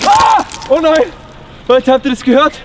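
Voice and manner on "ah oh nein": erschrockener Aufschrei, höher; plötzlich erschrecken; scared horror game streamer. Acted. Ast knackt, Sturzgefahr. (erschrockener Schrei)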